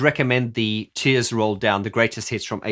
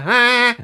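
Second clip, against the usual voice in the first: high buzzing ah; twangy ah; 12 semitones higher, 6 dB louder than usual. Shown as a demonstration. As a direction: buzz the vowel. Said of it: "if you try and go".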